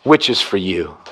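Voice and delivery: low and soft, dwelling on which; tender quote. Acted. Preacher savouring the words to his church, softly. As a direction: let the words land gently. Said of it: "I love these words next".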